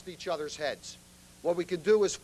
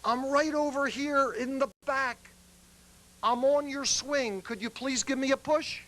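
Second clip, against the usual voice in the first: put-on higher drunk voice calling back; calling from a distance; 7 semitones higher than usual. Drunk on the swing asking for a push, calling from a distance. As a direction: call back from the yard, pitched up. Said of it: "from a slight distance, the words come".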